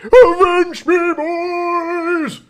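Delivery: high, drawn-out mock scream; imitated scream. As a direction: yell it full out, hammed-up and stretched.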